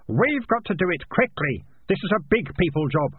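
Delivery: high, pressing urgency; urgent insistence. Acted. Melissa takes charge at the fire.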